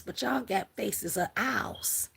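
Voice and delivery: slow, hushed mock whisper; whisper to cats. Teasing her window cats, in a slow whisper. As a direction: lean in and whisper it slowly, teasing. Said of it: "I said".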